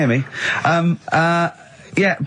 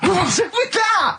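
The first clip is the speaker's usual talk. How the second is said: mock shocked shout; slower than usual. Mock parents hearing son on radio.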